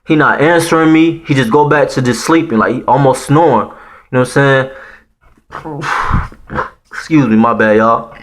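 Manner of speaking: ordinary delivery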